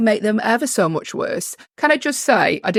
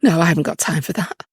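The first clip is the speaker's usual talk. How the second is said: usual speaking voice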